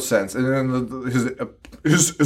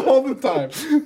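higher, shouted ALL the time; mock scream; 10 semitones higher, slower than usual. Shown as a demonstration. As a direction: yell it like every character does. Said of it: "I was just screaming right there".

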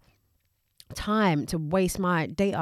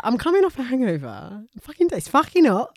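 hungover groan; 5 semitones higher, 4 dB louder than usual. Retold or imitated. Retelling friend's hungover complaint.

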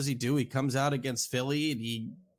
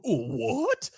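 surprised; 10 semitones higher than usual. Acted. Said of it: "And you'd go".

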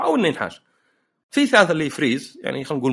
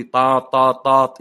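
vocal honks in short bursts, growing louder; car horn imitation; 5 dB louder, much slower than usual. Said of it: "اضرب له بوري". (تقليد بوري السيارة)

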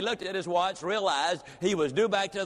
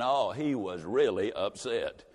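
low dramatic refrain; 7 semitones lower, slower than usual. A narrator's reading. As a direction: drop low and slow on the refrain. Storyteller's repeated refrain.

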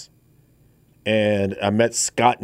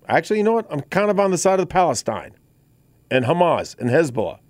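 sarcastic; 7 semitones higher, faster than usual. Pretend. Sarcastic mock of a lawmaker.

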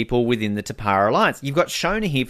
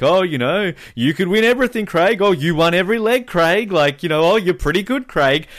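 higher and louder, sarcastic sweet-talking; mock flattery; 6 semitones higher, 3 dB louder than usual. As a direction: bait him with fake praise, sing-song. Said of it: "baiting Craig".

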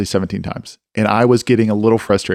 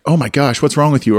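higher-pitched alarmed reproach, mildly acted; mock overreaction; 5 semitones higher than usual. Mentor overreacting to a kid's confession, mock alarm. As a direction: imitate a reactive mentor's shocked reply. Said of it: "instead of being like".

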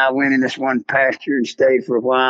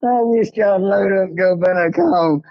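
higher, slow, worn-out lawman's plea; weary game warden; 7 semitones higher, slower than usual. Retold or imitated. Imitating the fed-up warden begging the boys to quit. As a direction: play the beaten-down warden, half laughing.

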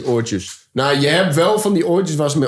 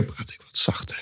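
whispering; 11 dB quieter than usual. Shown as a demonstration. Demonstrating volume levels to the hosts, whispering. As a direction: drop to a whisper. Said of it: "begin ik te fluisteren".

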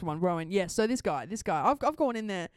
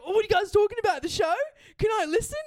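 eager fan; 7 semitones higher, 6 dB louder, faster than usual. Retold or imitated. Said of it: "And he's like".

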